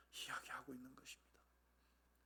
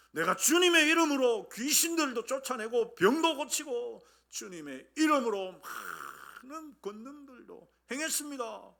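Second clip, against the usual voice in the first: loud, higher-pitched pleading protest from the pulpit; forceful preacher acting out a protest; preaching at full force; 7 semitones higher, 9 dB louder than usual. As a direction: plead the case with insistent, ringing emphasis.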